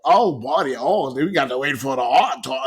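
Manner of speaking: badly faked Australian accent; Wayne's Australian accent. Co-host attempting a friend's voice for art talk, terrible Australian accent. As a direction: have a half-hearted go at an Australian accent. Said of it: "gonna try to do a Wayne accent".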